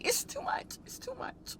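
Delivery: soft whispered sob; tearful whisper. Real, not acted. Vlogger overwhelmed in the car, whispering through tears. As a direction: breathe it out, defeated. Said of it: "crying in car".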